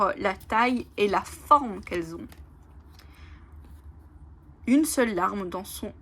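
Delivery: ordinary talk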